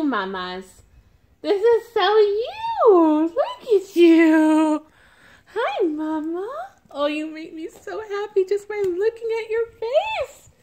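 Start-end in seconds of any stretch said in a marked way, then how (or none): 1.4-10.5 adoring high singsong gush. Real, not acted. Baby talk to doll.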